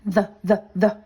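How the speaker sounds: loud, repeated "The" with a clear voiced th; sounding out th. Shown as a demonstration. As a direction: stress the th sound in "The" three times. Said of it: "T H makes the sound the".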